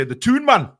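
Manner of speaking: loud, high mock proclamation; proud mock announcement. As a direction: call it out big for puzzled foreigners. Announcing his hometown to puzzled foreigners.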